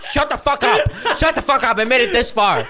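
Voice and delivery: high, loud, laughing mock panic. Disguised man begging the stewardess to hush, laughing loudly. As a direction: crack up while begging her to stop.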